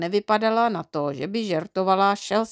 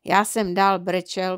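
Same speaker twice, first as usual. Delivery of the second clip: usual speaking voice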